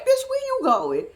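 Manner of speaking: high-pitched mock outrage; retold "where to?" in a high squawk; incredulous challenge. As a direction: call it out high, baffled. Narrator challenges friend in retold exchange.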